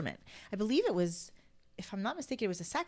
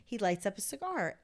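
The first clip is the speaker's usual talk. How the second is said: ordinary delivery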